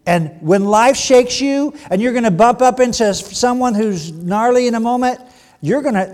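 loud, rising preacher's call; loud preaching push; preaching at full force. Real, not acted. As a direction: drive the point home loudly. Preacher building to a peak.

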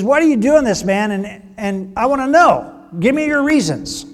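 a king pressing hard for the truth; exasperated king. Retold or imitated. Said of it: "and he says".

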